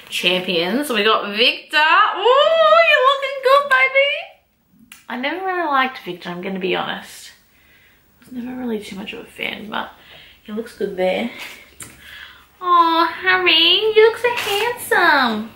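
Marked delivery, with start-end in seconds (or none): 1.8-4.1 high, teasing flirt with a picture; playful flirting. Pretend. Flirting with Victor's card. 12.6-15.5 high, slow adoring aww; mock-smitten aww; fond coo. Cooing at Harry's card.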